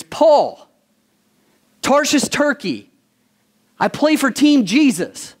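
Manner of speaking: high, ringing self-introduction, lightly acted; player's roll-call declaration. Preacher voices Paul introducing himself as a player, lightly acted declaration. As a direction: announce yourself proudly like a team player.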